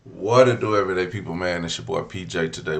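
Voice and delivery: loud, high, pumped greeting; hyping up the viewers; hype intro. Acted. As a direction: shout it out like a host opening a show.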